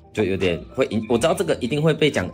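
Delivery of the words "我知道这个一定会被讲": ordinary talk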